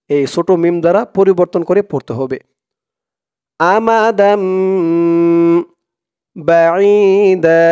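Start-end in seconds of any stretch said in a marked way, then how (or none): 3.6-7.6 slow, drawn-out Quranic chant; tajweed recitation. Shown as a demonstration. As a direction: recite it slowly with long held vowels. (ধীরে টেনে কুরআন তিলাওয়াত)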